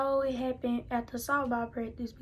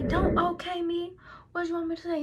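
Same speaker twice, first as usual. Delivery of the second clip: higher, louder retold back-and-forth; mock argument, pitched up; heated argument; 6 semitones higher, 4 dB louder than usual. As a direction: act out a mother-daughter quarrel. Acted quarrel with mother.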